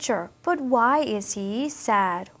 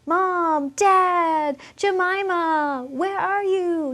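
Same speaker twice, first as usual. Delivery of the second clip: higher-pitched, drawn-out calls for family; acted shout, raised in pitch and stretched; calling out; 6 semitones higher, 4 dB louder, slower than usual. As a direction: call out like a lost baby looking for his parents. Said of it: "So he shouts".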